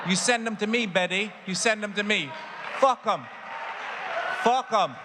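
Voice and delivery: egging her on, building to a yell; retelling the rant he gave neighbour Betty, going over the top. Retold or imitated. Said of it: "I may have went over the top with it".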